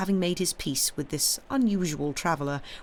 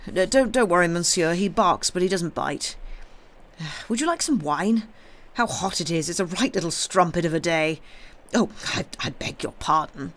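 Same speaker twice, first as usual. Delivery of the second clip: loud, hesitant host hurrying to please; flustered innkeeper; 4 dB louder than usual. A narrator's reading. Innkeeper reassuring a visitor about his dog, flustered. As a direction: stumble over yourself welcoming a guest.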